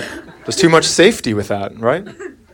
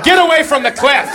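mock panicky mom; 10 semitones higher than usual. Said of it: "the mom is like".

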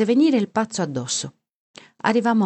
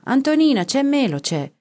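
high, raised call to the wife; 8 semitones higher, 4 dB louder than usual. A narrator's reading. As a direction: call through the house to someone. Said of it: "gridò verso la moglie".

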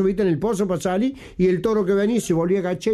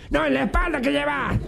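high, insistent demand; acted challenge from an unseen questioner; 8 semitones higher than usual. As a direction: press him for an answer, pitched high. Cemetery voice challenges the hunchback.

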